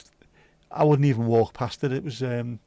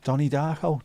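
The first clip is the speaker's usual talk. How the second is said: normal talking voice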